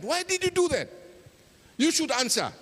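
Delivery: very high, heated demand for an answer; angry demand. Acted. Chope demanding Tabale answer, heated and high. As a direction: insist he explain himself.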